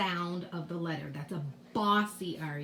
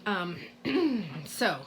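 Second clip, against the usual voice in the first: ordinary talk, nothing marked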